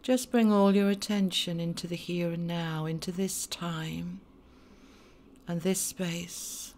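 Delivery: hushed voice guiding a meditation; guided meditation. Acted. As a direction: ease them into calm with long pauses. Leads a meditation.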